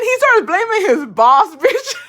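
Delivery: high, loud, breaking into laughter; real incredulous laugh; laughing in disbelief. Real, not acted. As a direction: crack up as you tell it. Cracks up at his excuse.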